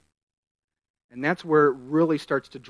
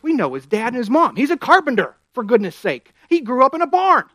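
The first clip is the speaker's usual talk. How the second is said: higher, louder scoffing villagers; indignant crowd; 9 semitones higher, 5 dB louder than usual. Retold or imitated. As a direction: scoff like neighbours who know his family. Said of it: "In other words".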